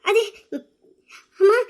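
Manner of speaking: faltering, tearful start; crying. Acted. Sister-in-law asked why she weeps, tearful and halting. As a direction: stammer between caught breaths. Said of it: "ஏன் அழுதுட்டு இருக்கீங்க".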